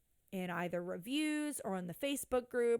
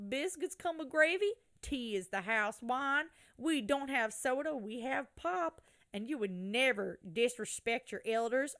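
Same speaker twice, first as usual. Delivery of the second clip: put-on country bumpkin accent; 2 semitones higher than usual. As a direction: read it out like a proud country bumpkin. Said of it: "country bumpkin accent".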